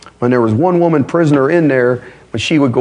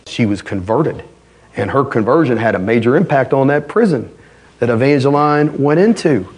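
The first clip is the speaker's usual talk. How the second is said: ordinary talk, nothing marked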